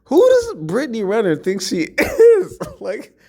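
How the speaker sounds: can barely get the words out for laughing; real laughter breaking into the words; laughing through it. Real, not acted. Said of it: "I'm just laughing because this is so hilarious".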